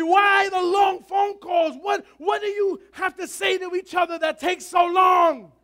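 slow, high, loud scolding shout; scolding mother. Retold or imitated. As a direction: shout like an exasperated mom. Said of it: "my mother would scream at me".

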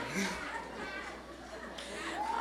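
high, soft laugh; light, high-pitched giggling; laughter. Real, not acted. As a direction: laugh at your own outburst. Laughs after her mock roar.

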